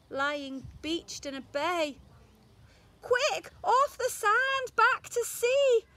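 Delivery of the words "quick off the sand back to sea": high, loud urgent cry; high and loud, urging him back to sea; cried out. A narrator's reading. Snail urges beached whale, a high loud cry. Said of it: "cried the snail".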